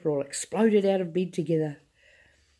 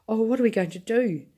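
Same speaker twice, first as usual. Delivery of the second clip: higher, louder mock wail; mild wail; 6 semitones higher, 4 dB louder than usual. A narrator's reading. Grandma fretting about their nightshirts, hammed-up higher wail. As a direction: wail it out in dismay. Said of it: "wailed Grandma Georgina".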